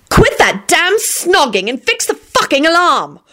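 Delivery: high, slow, exasperated command; angry order. Acted. Told to fix the alarm, angry order. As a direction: order them crossly, drawing the words out high.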